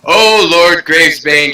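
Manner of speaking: swooning lady. Acted. Lady Sunskin falling into the lord's arms, hammed-up swoon. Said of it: "she swooned".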